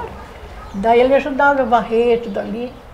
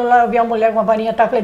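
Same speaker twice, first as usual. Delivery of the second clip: usual speaking voice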